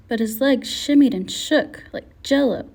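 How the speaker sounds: plain reading voice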